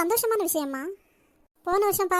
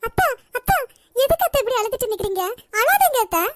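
higher, louder urgent pleading; anxious calling; 4 semitones higher, 5 dB louder than usual. Acted. Daughter-in-law hurrying to comfort crying mother-in-law, urgent and high. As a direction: rush in to stop her crying.

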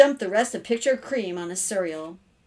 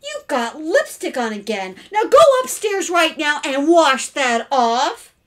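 raised, sharp parental order; stern scolding; 7 semitones higher, 9 dB louder than usual. Acted. Mother ordering her daughter upstairs, loud and stern. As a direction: build to a loud command.

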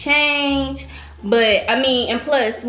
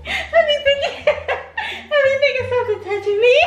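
high, squealing laughter; laughing. Real, not acted. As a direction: squeal through the giggles. Laughing fit.

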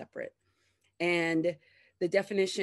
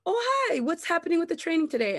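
very high chirpy coworker voice, quick; bright coworker; 11 semitones higher, 4 dB louder, faster than usual. Retold or imitated. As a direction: chirp up high, all breezy. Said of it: "And then be like".

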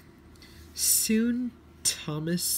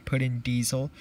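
ordinary delivery, nothing marked

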